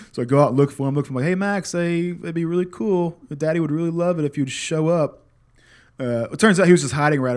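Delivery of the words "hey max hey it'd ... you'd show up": put-on higher sing-song coaxing to a hidden child; coaxing call. Dad calling his hiding son, higher coaxing sing-song. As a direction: lure the kid out sweetly, higher.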